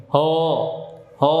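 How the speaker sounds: "Ho" with a heavy, rounded vowel for "ha"; wrong-way heavy, rounded "Ho". Shown as a demonstration. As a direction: say HA thickened toward "Ho". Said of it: "suaranya tidak naik".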